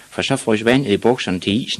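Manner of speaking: ordinary delivery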